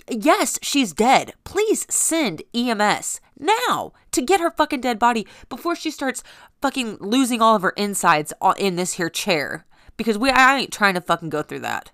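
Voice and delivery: furious demand. Retold or imitated. Telling the 911 operator to send EMS now, loud furious demand. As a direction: bark orders down the phone.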